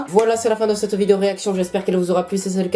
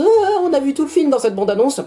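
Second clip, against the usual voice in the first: mock whining complainers; 8 semitones higher than usual. YouTuber imitating people who complain about trailers, high mocking voice. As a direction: mimic moaners in a high voice.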